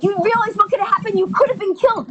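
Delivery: high-pitched, fretful mock alarm; upset. Worriers to holdup victim after the fact, upset. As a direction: imitate people getting worked up over what-ifs. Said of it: "get upset".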